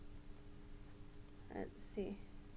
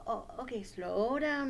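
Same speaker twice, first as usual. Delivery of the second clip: normal talking voice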